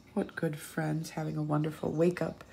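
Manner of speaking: ordinary delivery, nothing marked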